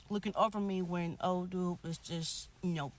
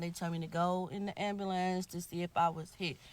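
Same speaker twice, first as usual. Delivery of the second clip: usual speaking voice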